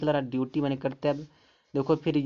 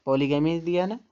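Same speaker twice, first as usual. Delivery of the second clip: ordinary talk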